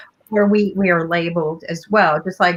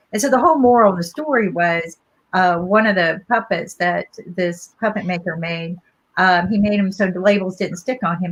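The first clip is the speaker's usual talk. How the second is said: ordinary delivery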